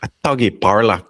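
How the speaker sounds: put-on a quick, light stab at a Scottish accent; mock Scottish accent. Joking to his co-host, a light mock Scottish accent. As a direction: tries the phrase in a hammy Scottish accent. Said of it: "it was my attempt at Scottish accent".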